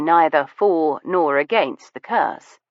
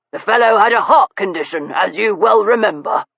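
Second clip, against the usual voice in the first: sharp retort; 4 semitones higher than usual. A narrator's reading. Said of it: "added sharply".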